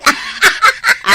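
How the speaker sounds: a high burst of laughter; teasing laugh. Acted. Friend teasing Sara about getting a tattoo, high teasing laugh. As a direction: laugh it off mockingly.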